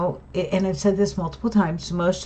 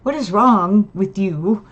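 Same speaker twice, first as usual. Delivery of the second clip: retold exasperation; 3 semitones higher, 4 dB louder than usual. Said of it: "I'm like".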